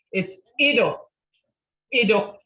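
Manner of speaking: "Ido" said firmly with a d, never a t; Ido with a clear d. Shown as a demonstration. Teacher correcting students, firm Ido. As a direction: stress the d in "Ido". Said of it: "no such word as Ito".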